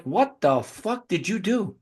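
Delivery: slow, drawn-out rising question; mock incredulous repeat; retold disbelief. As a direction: drag it out, rising at the end. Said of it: "I'm like".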